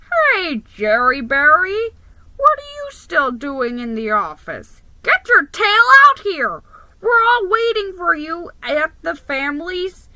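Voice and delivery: loud, high cousin calling; boisterous cousin yelling over the phone. A narrator's reading. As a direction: shout him out of the office. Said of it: "he yelled".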